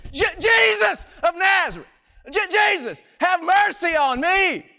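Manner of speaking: desperate shout, high and loud; yelling out. Acted. Blind beggar shouting to Jesus over the crowd, desperate yell. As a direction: cry out to be heard over the crowd. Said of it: "he starts yelling out".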